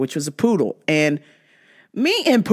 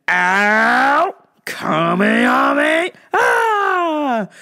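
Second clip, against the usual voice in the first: mock pain cry; 5 semitones higher, much slower than usual. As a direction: wail about the sore wrist.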